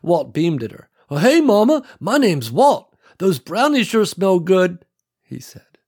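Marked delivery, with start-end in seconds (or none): 1.1-4.9 loud, high eager greeting; eager buddy voice. Acted. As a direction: greet her hungrily and loud.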